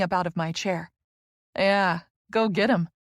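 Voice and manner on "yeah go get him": slow, playful teasing. A narrator's reading. Friend teasing her as she goes off, playful. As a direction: egg her friend on with a grin. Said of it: "she teases".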